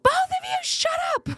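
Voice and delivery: very high mock scream; imitating mom fed up at the stairs; mother at the bottom of the stairs, screaming very high. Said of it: "scream at the top of her lungs".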